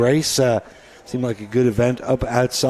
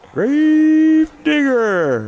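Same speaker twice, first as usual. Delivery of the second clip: booming, higher, very slow announcer call; monster truck ad; 13 semitones higher, 6 dB louder, much slower than usual. Retold or imitated. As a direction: roar the name like a monster truck commercial. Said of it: "when they used to come to Skydome".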